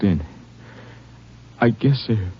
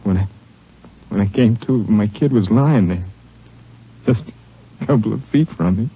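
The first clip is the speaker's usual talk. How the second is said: grieving, halting; 5 semitones higher than usual. Acted. Wounded father telling the Ranger of his boy, grief-stricken and halting. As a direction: falter through it, struggling to go on.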